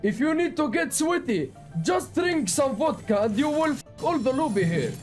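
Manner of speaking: thick put-on Russian accent, broken English; joking Russian accent; fake Russian accent. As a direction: play a boastful Russian gamer in a heavy Russian accent.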